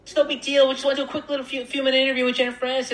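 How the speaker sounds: very high squeaky self-mockery; little squeaky voice. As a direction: plead in a tiny, squeaky voice. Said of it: "me with my little squeaky voice".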